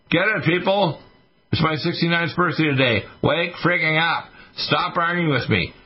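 high, exasperated haranguing of listeners; angry rant. Real, not acted. Ranting at listeners, arguing with them.

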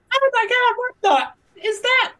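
very high, loud mock shriek; horrified tourist. Tourist spotting a deadly animal on tour, hammed-up terror. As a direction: shriek in squeaky cartoon panic.

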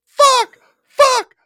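very high, loud, drawn-out panicked screams; hammed-up screaming of a terrified rider; imitated freak-out. Man on a roller coaster, freaking out in screams. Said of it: "He's freaking out".

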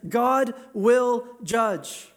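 slow, weighty stress on each of "God will judge"; word-by-word emphasis. Shown as a demonstration. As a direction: set "God", "will", "judge" apart, very slowly. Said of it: "Look at those three words".

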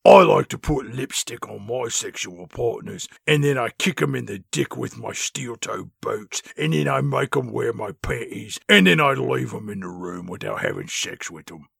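put-on low cockney girl voice; mock cockney. Spice Girls banter.

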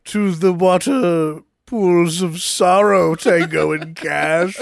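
slow, drawn-out theatrical welcome; mock villain. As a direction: intone it like a hammy menacing host.

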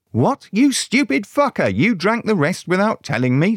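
high-pitched outraged screech; shrill-high scolding outburst; angry screech. Acted. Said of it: "screeched Gretel".

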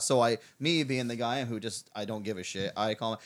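ordinary talk

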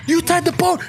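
mock accusation. Two dudes blame each other, imitated.

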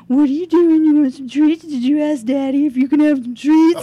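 high, loud cooing at cats; baby talk to pets. Retold or imitated. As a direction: coo at the cats like babies.

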